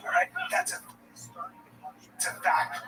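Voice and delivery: ordinary talk, nothing marked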